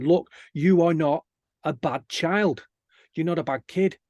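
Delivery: slow and deliberate, each phrase set apart; slow, emphatic reassurance. Retold or imitated. Speaker telling schoolkids they aren't bad, slow and emphatic. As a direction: spell it out firmly for a child.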